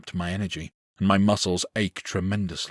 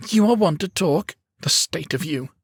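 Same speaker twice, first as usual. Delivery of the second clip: much higher, louder defiant retort; spitting back; 11 semitones higher, 5 dB louder than usual. A narrator's reading. Boy insulting the swordsman, defiant spitting retort. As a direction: fire it back with contempt. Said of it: "He spits back at me".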